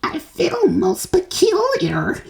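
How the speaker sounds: put-on high, slow, gasped girl's voice; faint little girl; gasping girl. A narrator's reading. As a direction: gasp it out feeling strange. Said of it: "gasped Violet".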